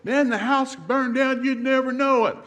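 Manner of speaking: hammed-up exasperated wife; imitating a wife. Wife complaining her sleeping husband would miss a fire, mock high voice. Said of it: "that's why women say".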